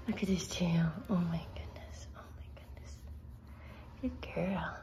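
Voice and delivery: soft whisper to the puppy. Real, not acted. Owner whispering to the puppy on the couch. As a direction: hush it, leaning in close.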